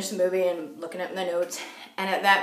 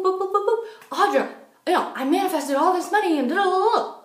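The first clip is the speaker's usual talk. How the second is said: high, quick, sing-song retelling; excited reporting; 9 semitones higher, faster than usual. Retold or imitated. Caller reporting manifested money to friend, excited. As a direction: rattle it off high and giddy.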